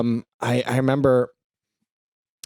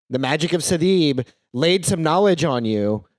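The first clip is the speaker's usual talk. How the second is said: normal talking voice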